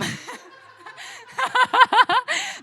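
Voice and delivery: high, loud burst of laughter; laughing. Real, not acted. Singer admitting she exaggerated her mom, real laughing. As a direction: crack up at your own joke.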